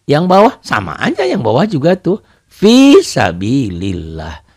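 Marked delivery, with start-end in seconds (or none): 2.5-4.5 very slow recitation, lah of sabilillah thin; thin Allah recited. Shown as a demonstration. Teacher reciting for students, slow and thin. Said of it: "Kenapa dibaca A?".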